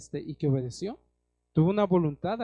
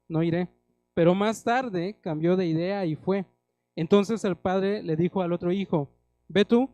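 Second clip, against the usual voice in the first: louder, ringing sermon reading of the parable; preaching scripture reading; 2 semitones higher, 6 dB louder than usual. Acted. Pastor reading the parable of two sons to the congregation, preaching aloud. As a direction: proclaim the story to the whole church.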